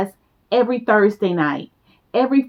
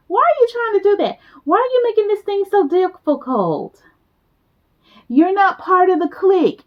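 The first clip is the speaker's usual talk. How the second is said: exasperated; 9 semitones higher than usual. Real, not acted. Exasperated pleading with the listener.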